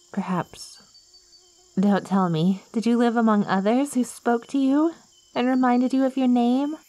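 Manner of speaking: normal talking voice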